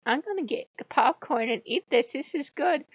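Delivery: hint of a gleeful bystander; amused onlooker impression. Friend settling in to watch the shouting match, lightly mimicked amusement.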